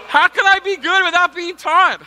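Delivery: high, loud exaggerated protest; mock indignant whine. Pastor joking about never learning to cook, mock-indignant loud whine. Mock self-pity outburst.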